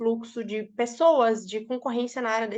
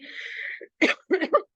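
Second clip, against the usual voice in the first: sputtering cough after swallowing water wrong; coughing fit from choking on water; choking on a drink; 7 semitones higher than usual. Real, not acted. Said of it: "Eu engasguei com água".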